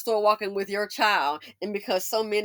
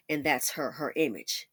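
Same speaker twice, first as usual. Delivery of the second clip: normal talking voice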